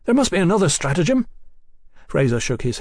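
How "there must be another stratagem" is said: fast, high-pitched objection; quick raised-voice dismay; agitated cry. A narrator's reading. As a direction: cry out urgently, rushing the words. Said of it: "Brian cried".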